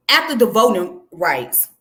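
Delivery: normal talking voice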